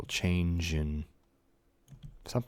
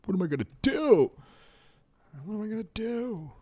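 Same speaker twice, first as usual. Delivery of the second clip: mock panic; 13 semitones higher, 4 dB louder, faster than usual. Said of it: "I was like".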